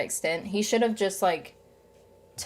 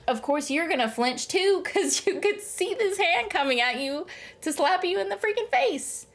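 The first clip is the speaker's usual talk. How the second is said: exasperated, pitch climbing very high; high-pitched, half-amused exasperation; incredulous; 9 semitones higher than usual. Real, not acted. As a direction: argue the obvious, rising higher and higher. Arguing that anyone would flinch.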